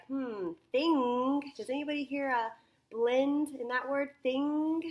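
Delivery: high, loud classroom questioning; prompting teacher. Shown as a demonstration. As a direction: quiz the class, ringing out the key word. Teacher prompts class to hear blend.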